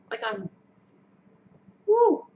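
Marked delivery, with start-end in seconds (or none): 1.9-2.3 a high, loud, choked-up woo; emotional woo. Real, not acted. Parent welling up over daughter, a high choked woo. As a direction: let the tears break out in a cry. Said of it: "tears, just".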